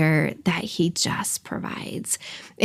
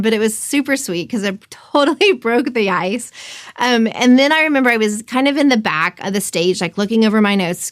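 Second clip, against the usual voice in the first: ordinary talk, nothing marked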